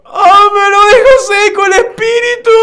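high, loud sobbing cry; crying shout. Acted. Reading a frightened game character aloud. (grito llorando, agudo y fuerte)

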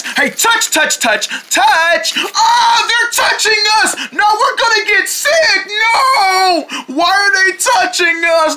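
high screaming panic; hysterical panic. Acted. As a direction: shriek in terror of germs. Deerlings panic at being touched.

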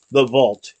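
usual speaking voice

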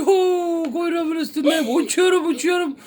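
high, loud thrill of flying; excited whooping. Acted. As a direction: whoop as if soaring for the first time. Kuyruğunun üstünde uçmayı deniyor. (heyecanlı uçuş çığlıkları)

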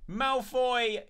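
high, loud burst of thanks; excited shout. Real, not acted. Streamer loudly calling out a gift-subber's name, excited.

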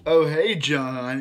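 loud slow greeting. Retold or imitated. Retelling a character's greeting.

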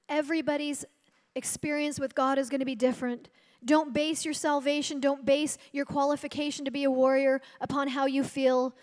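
normal talking voice

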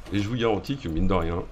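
ordinary talk

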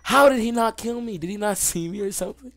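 high, loud disbelief breaking into a laugh; shocked laughing disbelief. Real, not acted. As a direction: shout it in stunned surprise. Surviving by luck, laughing.

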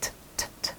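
"ch ch ch" as tiny voiceless puffs of aspirated t, without a vowel; light half-sound. Shown as a demonstration. Teacher modelling the second ending for students, light half-sound. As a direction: release "ch ch ch" lightly, only half a sound each. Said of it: "เบาๆ".

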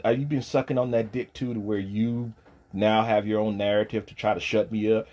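ordinary delivery

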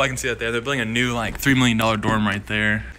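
usual speaking voice

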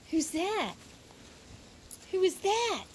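high sing-song talk to a pet. Real, not acted. Baby talk to the dog.

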